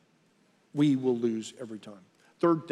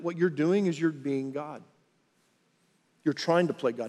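normal talking voice

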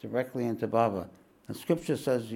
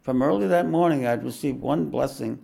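fuller, brighter storybook reading; devoted first-person narration; memoir reading; 2 semitones higher, 5 dB louder than usual. Acted. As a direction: read the story to the room with wonder. Reads the memoir aloud.

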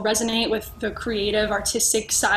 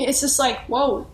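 ordinary talk, nothing marked

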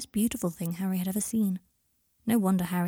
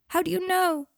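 put-on high-pitched child's wail; plaintive cry, pitched way up; 10 semitones higher, 4 dB louder than usual. A narrator's reading. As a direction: wail it in upset protest. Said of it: "wailed Scorpius".

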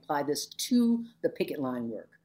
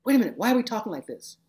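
higher, louder puzzled student stopping the talk; sudden realization; 7 semitones higher, 5 dB louder, faster than usual. Retold or imitated. Student noticing the class talks in analysis, higher and surprised. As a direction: act a student catching himself mid-discussion. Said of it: "somebody would go".

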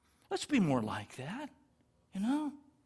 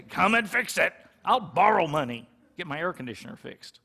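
urgent demand; 6 dB louder than usual. Retold or imitated.

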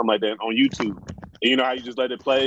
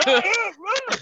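high, strained imitation of someone wailing; mock crying wail. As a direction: ham up the sounds from the next room, way up high. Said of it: "They're like".

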